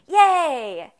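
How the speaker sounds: loud, high happy yay; excited cheer. Real, not acted.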